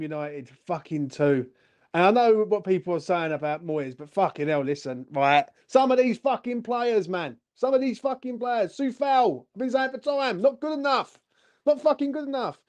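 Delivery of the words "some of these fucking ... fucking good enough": high-pitched, exasperated rant; livid. Real, not acted. West Ham fan raging at the players, livid. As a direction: complain angrily, voice pushed up high. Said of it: "I'm fucking livid".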